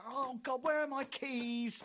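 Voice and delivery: high, slow whining plea; mock whiny search. Person on earth whining to God about keys. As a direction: moan it while hunting.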